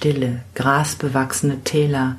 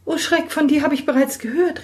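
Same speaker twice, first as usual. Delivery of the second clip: put-on high, gasping girl recoiling in fright; young girl crying out in fear; frightened girl cry; 12 semitones higher than usual. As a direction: shrink back in alarm. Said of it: "rief das Mädchen".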